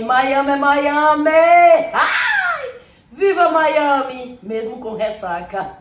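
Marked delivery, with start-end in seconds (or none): 0.0-4.4 high, slow cheer; excited cheer. Real, not acted. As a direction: cheer it out, thrilled. Celebrating being in Miami.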